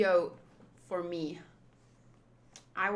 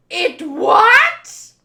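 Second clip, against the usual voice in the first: high, very loud rising question; stunned shouted question; shocked yell; 8 semitones higher, 15 dB louder than usual. Acted. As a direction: blurt it out in disbelief. Reacts to shocking news.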